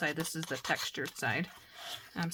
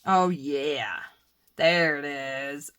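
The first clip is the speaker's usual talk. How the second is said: normal talking voice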